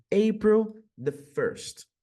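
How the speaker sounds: slow, careful "April the second"; slow model date. Shown as a demonstration. Teacher slowly models an American date. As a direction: give the date slowly as an example. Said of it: "Como seria dia primeiro de abril?".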